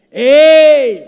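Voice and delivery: loud high shout for help. Retold or imitated. Climber hanging from a branch calls out, shouting. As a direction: call out across the cliff. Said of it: "empezó a gritar".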